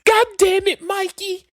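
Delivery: hammed-up wife yelling out the window. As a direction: scream down at the husband. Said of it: "She just".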